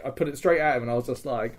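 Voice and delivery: ordinary delivery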